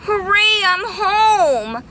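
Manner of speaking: high, loud cheer; cheering bee. Acted. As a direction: shout for joy. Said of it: "cheered the greedy bee".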